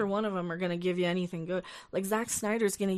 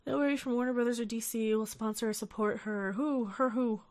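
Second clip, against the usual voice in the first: normal talking voice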